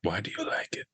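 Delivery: hushed teasing whisper. Acted. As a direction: whisper the question playfully. Teasing host question, whispered.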